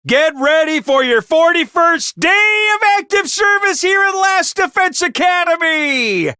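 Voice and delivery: rally the cadets for the day; bright announcer energy; upbeat wake-up call. Acted. Cheery morning announcement to troops.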